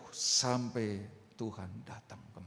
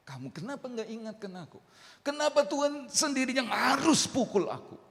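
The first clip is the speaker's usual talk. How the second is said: accusing complaint; 11 semitones higher, 4 dB louder than usual. Acted. David blames Nathan.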